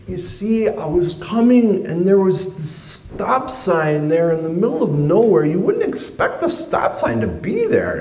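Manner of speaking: high, hurried excuse-making; nervous excuses. Retold or imitated. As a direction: talk fast to dodge blame. Teen making excuses to dad.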